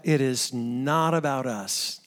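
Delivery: usual speaking voice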